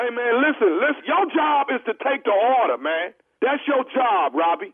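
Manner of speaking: loud, insistent telling-off; pushy put-on irritation. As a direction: push the clerk to obey. Prank caller bossing the store clerk.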